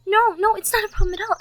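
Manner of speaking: fast, loud, eager denial; giddy tumbling words; flustered rush. Acted. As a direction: hurry to reassure him. Flustered reassurance.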